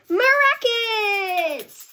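high, loud and slow, stretched word; triumphant drawn-out. A narrator's reading. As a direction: celebrate the punchline. Crowd goes crackers climax.

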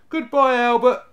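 high, loud call over the shoulder; casual goodbye hollered high; called out casually. A narrator's reading. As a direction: shout a breezy farewell to someone behind you. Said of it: "The eldest called over his shoulder".

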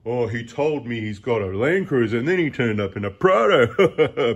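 mocking gossip voice. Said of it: "just stop with the".

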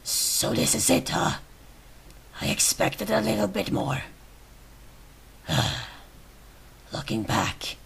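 weak, whispery last words; dying character. Acted. Shot character looks back on life, faint dying voice.

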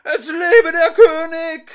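very high, loud, slow shout. Acted. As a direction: yell it across the hall. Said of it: "schrie in diesem Moment der Henker".

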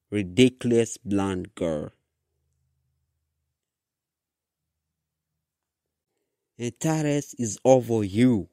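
loud, scornful character voice; growled. A narrator's reading. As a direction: read it as a forceful, angry taunt. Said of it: "Clementine growled".

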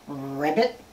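low, quiet ribbit; soft low frog call; frog croak. Pretend. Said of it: "he goes".

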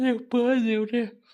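a groan of effort turning into a prayer; strained cry. Acted. Old woman struggling alone at night.

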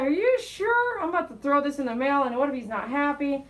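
high, loud, worried checking; anxious double-check. Retold or imitated. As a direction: fret loudly before mailing. Worried about mailing the order.

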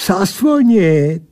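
high, slow, drawn-out creature reply; slow mocking answer; taunting creature. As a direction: stretch the word out in an accented creature voice. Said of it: "odpověděl".

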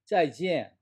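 짜이지엔 high, each half falling sharply; two fourth tones. Shown as a demonstration. Teacher models goodbye for students, 짜이지엔 with double falling tone. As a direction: hit 짜이 and 지엔 as two firm drops. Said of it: "사성, 사성".

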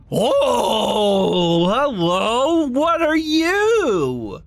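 high, slow cooing; overwhelmed by cuteness. Real, not acted. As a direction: coo at the little creature. Meets cute creature.

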